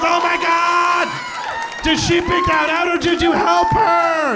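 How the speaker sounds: high, loud gushing shout; hammed-up shriek of amazement; awed excitement. Acts friend's awed reaction.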